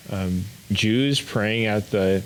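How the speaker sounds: normal talking voice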